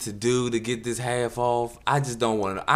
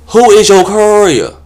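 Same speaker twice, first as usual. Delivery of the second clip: much higher, loud camp voice; mock prissy guy; 10 semitones higher, 15 dB louder, slower than usual. Solicitor repeating his name, high and camp.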